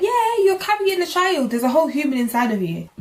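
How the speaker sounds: high, matter-of-fact midwife; lightly voiced midwife stating the obvious; a hint of a breezy midwife. Retelling midwife's reply.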